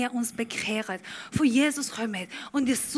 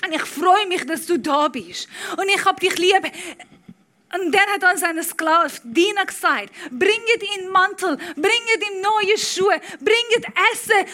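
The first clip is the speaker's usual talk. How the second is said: louder, rising preacher's build, punching each line; full-force preaching; 8 semitones higher, 8 dB louder than usual. Acted. Preacher telling the prodigal son story to the church, full-force preaching. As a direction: preach it hard to the congregation.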